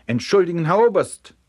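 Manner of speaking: high, loud climbing apology; flustered apology. A narrator's reading. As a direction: blurt it up to a superior officer. Frightened soldier's escalating apologies.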